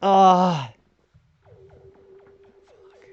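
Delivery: high, whiny little nerd voice; nerdy voice. Acted. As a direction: play it as a fussy little nerd. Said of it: "I'm doing my little nerdy voice here".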